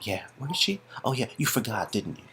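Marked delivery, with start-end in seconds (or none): none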